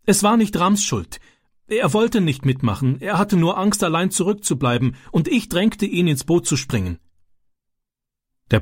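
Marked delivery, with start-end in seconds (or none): 0.0-7.0 put-on high, earnest plea of a boy; pleading boy. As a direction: defend your friend urgently. Francis pleads for his friend.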